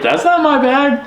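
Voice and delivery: high, disbelieving denial; hammed-up alarm at the wrong bag; mock disbelief. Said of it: "I'm like".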